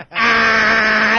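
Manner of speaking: imitated clown scream. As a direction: let out the clown's shriek. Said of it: "he's just screaming at him".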